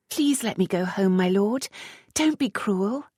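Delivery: high wheedling plea; high flighty plea to let her go; pleading coquette. Acted. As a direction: beg him sweetly, a bit silly. Captive pleads with earl.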